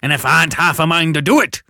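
loud, high menacing threat; threatening convict. Acted. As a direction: threaten the boy loudly. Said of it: "with a threatening shake of his head".

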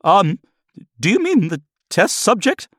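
very high, hesitant question; squeaky nervous assistant; timid technician. Acted. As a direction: ask warily, afraid to annoy.

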